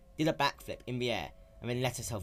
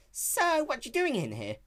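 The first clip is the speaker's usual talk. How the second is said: raised, brash tomboy pony voice; lightly raised young heroine; Rainbow Dash voice; 4 dB louder than usual. Acted. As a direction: play her sporty and cocky.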